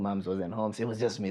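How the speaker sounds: ordinary delivery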